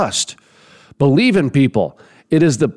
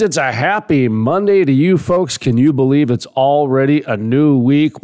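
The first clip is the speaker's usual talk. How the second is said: ordinary talk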